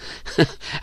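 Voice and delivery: short chuckle; soft, breathy chuckle; laugh. Acted. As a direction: laugh briefly under the breath. Laughter after roared with laughter.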